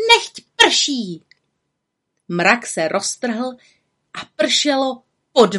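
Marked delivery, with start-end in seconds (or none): 0.1-1.2 high, ringing command; called-out spell. A narrator's reading. Little witch ordering the cloud to rain, calling out. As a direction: cry it out as an incantation.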